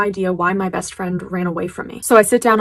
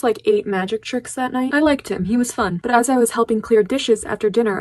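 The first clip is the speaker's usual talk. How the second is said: ordinary delivery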